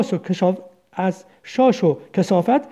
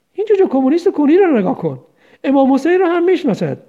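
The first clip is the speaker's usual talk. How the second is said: mocking; 6 semitones higher than usual. Jailer mocks prisoner.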